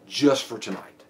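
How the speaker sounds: "Just for tonight" low and soft after a long pause; pause before phrase. Shown as a demonstration. Officer offering suspect an out, low "Just for tonight". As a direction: hold a beat, then drop "Just for tonight" quietly. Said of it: "a little pause, and I'll say".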